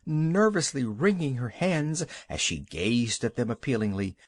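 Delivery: usual speaking voice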